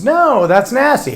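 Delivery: an interviewee recoiling from chocolate-covered sprouts; mock disgusted. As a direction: pull a face at the thought of it. Same answer reused for an edit.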